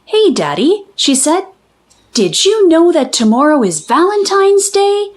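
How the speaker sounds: lightly voiced little girl. A narrator's reading. Girl greets her dad, lightly voiced and eager. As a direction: chirp it like a keen young girl. Child voice in story.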